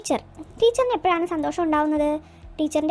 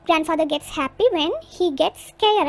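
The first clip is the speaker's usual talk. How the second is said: very slow, each word set apart for the class to write; slow dictation; much slower than usual. Shown as a demonstration. Teacher dictating to the class, slow and deliberate. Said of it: "teacher dictates answer slowly".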